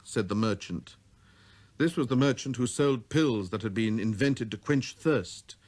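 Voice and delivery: plain reading voice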